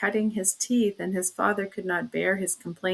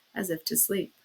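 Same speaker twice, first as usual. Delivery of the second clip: plain reading voice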